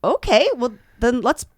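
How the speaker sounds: high surprised start, loud rallying finish; acted dawning enthusiasm. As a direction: replay being won over, building to a rally. Acts growing enthusiasm at the offers.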